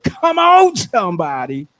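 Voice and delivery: rally the crowd with a big, high "come on"; rousing holler. Shown as a demonstration. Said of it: "I said, not come on, somebody, but".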